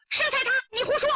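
high, fast retort; indignant protest. Acted. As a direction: blurt out a frightened denial. Maid denies the accusation. (惊慌地高声反驳)

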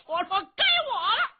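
high, fast, booming shout; loud call. Acted. Xiong Kuohai charging onto the field, bellowing that it's his turn. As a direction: bellow to claim your turn in the fight. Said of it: "高声喊嚷".

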